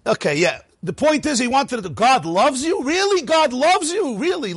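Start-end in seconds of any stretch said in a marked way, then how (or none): 1.9-4.5 high incredulous "Really?"; mock disbelief. Teacher mocking the claim to students, incredulous high scoff. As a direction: scoff at the idea, pitched up. Mocking the idea God needs love.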